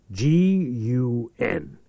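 each letter slow and separate; spelled out. Acted. Reich spells out the strange word for Church, slowly. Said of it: "spells the word".

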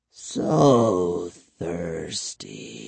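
very slow, low, soft vampire voice; thirsty vampire murmur. A narrator's reading. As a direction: murmur it low, stretching each word. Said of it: "he murmured".

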